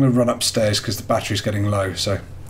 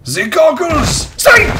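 high, loud excited shout; 5 dB louder, much slower than usual. Real, not acted. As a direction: yell out in delighted discovery. Finds goggles in horror game.

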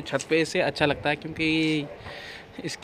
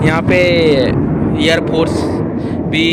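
loud, higher, excited over plane noise; shouting over plane; 4 semitones higher, 12 dB louder than usual. Real, not acted. (हवाई जहाज़ के शोर में ज़ोर से)

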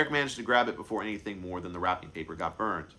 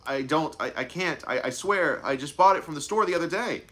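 put-on high, pushed, pleading child; desperate pleading; 8 semitones higher, 5 dB louder than usual. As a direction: beg to be believed. Child pleading he's innocent.

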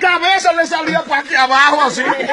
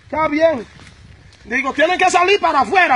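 ordinary talk, nothing marked

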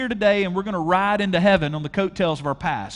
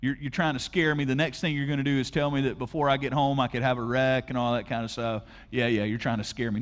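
lower, dismissive skeptic voice; skeptical churchgoer; 6 semitones lower, 3 dB quieter than usual. Retold or imitated. Imagined listener to pastor, low and dismissive. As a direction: brush the preacher off, a bit lower. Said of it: "And you go".

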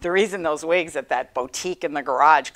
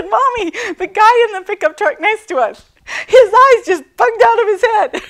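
high, loud, bursting with laughter; can barely get it out for laughing; laughing retelling; 11 dB louder than usual. Retold or imitated. Daughter's excited report, mother laughing.

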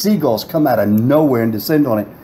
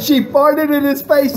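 slower, incredulous high-pitched punchline; high, clear repeat; 13 semitones higher, slower than usual. Retold or imitated. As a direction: spell it out in a high, excited voice. Repeats friend's words clearly for listeners.

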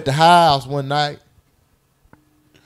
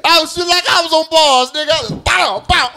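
excited gun sounds; 9 semitones higher than usual. Real, not acted. Gunshot imitation, laughing.

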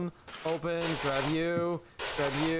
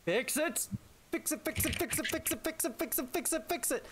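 high, rapid repeated pleading; urgent pleading; 9 semitones higher, 4 dB quieter than usual. Real, not acted. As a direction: beg them to fix it fast. Urgent plea, audio broken.

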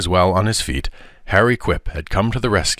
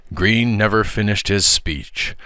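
ordinary delivery, nothing marked

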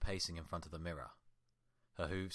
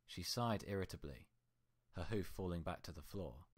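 plain reading voice

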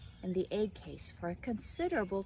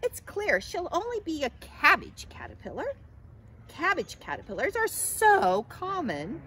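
high, loud snobbish disdain; snooty caterpillar putting someone down; haughty sneer; 9 semitones higher, 7 dB louder than usual. A narrator's reading. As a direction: sneer from on high, stretching the emphasis. Scowling Katisha goes on sneering.

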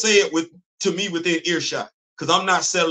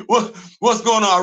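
ordinary delivery

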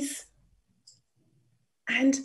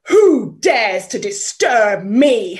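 louder, booming angry lion; 8 dB louder than usual. Acted. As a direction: demand it like an angry king of the forest. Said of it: "was furious".

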